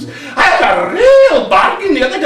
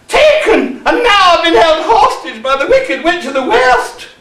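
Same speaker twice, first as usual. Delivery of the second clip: hammed-up damsel in distress; mock melodrama; 2 semitones higher than usual. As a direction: play it big, like a pantomime dame. Dame melodramatically recounts her capture.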